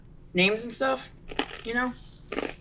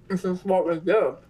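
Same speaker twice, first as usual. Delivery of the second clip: talking with a mouthful; chewing while talking; mouth full. Real, not acted. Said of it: "I'm talking with my mouth full".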